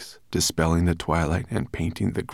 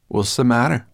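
higher, louder, mocking question; sarcastic; 6 semitones higher, 6 dB louder than usual. A narrator's reading. Sorrel teasing dizzy Ben, sarcastic. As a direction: needle with a rising question. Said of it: "asked Sorrel sarcastically".

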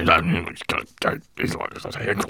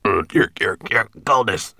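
calling out; 8 dB louder than usual. Acted. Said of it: "he called softly".

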